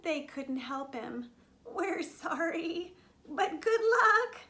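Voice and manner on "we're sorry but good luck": slow, high, bleaty lamb voice; apologetic lambs. Acted. Lambs cannot help mouse, slow high apology. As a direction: draw it out, rising louder on the good wishes.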